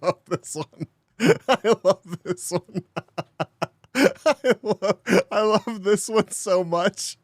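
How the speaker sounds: high voice breaking into laughter; words squeezed out between laughs; laughing hard. Real, not acted. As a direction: talk through helpless giggles. Cracking up at played message.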